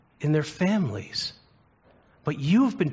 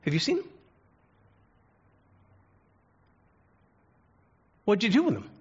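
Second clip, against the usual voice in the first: a much higher voice, asking the room for his son; Richard Pryor as God; 7 semitones higher than usual. Retold or imitated. Preacher voicing Richard Pryor as God looking for Jesus, high anxious calls. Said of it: "And so".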